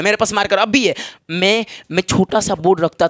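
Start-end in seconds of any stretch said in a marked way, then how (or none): none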